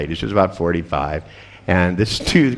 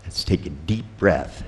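soft hypnotic voice; 3 semitones lower, 8 dB quieter than usual. Shown as a demonstration. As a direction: ease her into trance. Continues induction.

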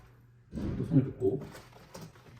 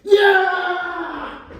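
triumphant cry; 12 dB louder, much slower than usual. Acted.

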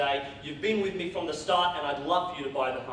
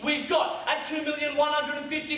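auctioneer calling; 11 semitones higher, 3 dB louder than usual. Acted.

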